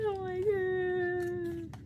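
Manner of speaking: very slow, high, drawn-out whimper; overwhelmed whimper. Real, not acted. Fan moved by the comeback whimpers slowly. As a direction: draw it out, overcome.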